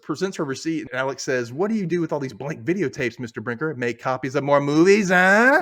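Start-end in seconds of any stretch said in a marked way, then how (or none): 1.5-5.6 put-on old gangster-movie interrogation, building louder to the end; mock accusation, rising into a loud "huh"; James Cagney style. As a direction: imitate a movie tough guy grilling a suspect. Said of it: "The way he says it".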